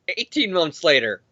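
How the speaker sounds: usual speaking voice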